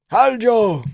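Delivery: put-on very high, very loud accented shouting; frantic high Georgian coach yelling; Merab impression. Merab voiced.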